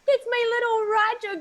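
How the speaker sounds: high, loud cooing at a pet; baby talk to a dog. Acted. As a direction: gush over a little dog. Owner cooing at her dog.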